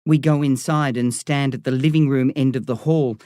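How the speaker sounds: ordinary delivery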